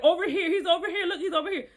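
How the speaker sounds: high, sing-song voice of a guiding star; talking star calling. Acted. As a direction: play the star pointing the way, pitched high. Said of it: "It's saying, like".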